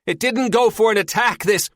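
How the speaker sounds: high, loud screamed line. A narrator's reading. Terry as the boat rocks violently, screaming. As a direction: scream it over the rocking boat. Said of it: "she screams as the boat rocks".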